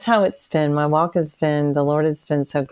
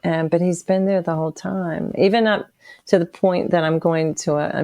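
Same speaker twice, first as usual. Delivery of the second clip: ordinary talk, nothing marked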